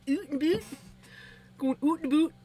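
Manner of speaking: high mock Canadian "oot and boot". Shown as a demonstration. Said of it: "Canadian, oot and boot".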